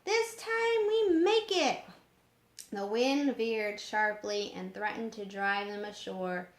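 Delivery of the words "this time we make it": high, loud joyful cheer; cheering boy. A narrator's reading. Johnny cheering as the ship finally moves, excited cheer. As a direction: cheer it out with glee. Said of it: "Johnny cheered".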